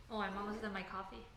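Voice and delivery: usual speaking voice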